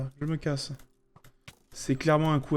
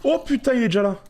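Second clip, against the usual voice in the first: startled high yelp; scared horror game streamer; 9 semitones higher, 5 dB louder than usual. Real, not acted. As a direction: jump scare, high and loud. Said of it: "il m'a fait peur".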